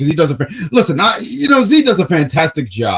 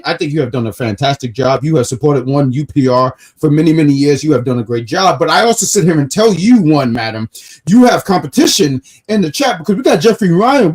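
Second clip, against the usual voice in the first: ordinary delivery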